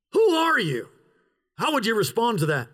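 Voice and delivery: usual speaking voice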